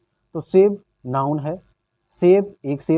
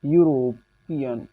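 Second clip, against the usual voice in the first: European drawn out very slowly, syllable by syllable; dictating European slowly; slow dictation; much slower than usual. Shown as a demonstration. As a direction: stretch out European slowly for students to catch. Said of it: "मैं कह दूँ".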